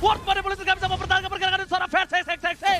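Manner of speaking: rising, loud esports hype call; fighting game announcer. Acted.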